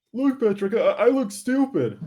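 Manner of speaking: high, loud cartoon sponge whine; SpongeBob. Player quotes the cartoon episode, high loud SpongeBob voice. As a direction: squeak it out like SpongeBob. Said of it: "SpongeBob episode".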